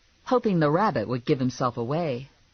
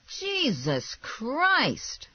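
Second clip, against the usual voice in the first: high, very drawn-out oath; exasperated; 11 semitones higher, much slower than usual. A narrator's reading. Man finally let in at the door, exasperated. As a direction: stretch each word out in exasperation.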